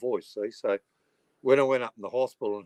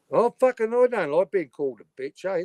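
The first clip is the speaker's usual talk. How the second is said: higher, louder hammed-up hurt protest; jokey fake outrage at a viewer; mock offended; 7 semitones higher, 5 dB louder than usual. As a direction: pretend to be wounded by the insult, tongue in cheek. Mock hurt after being called bitch.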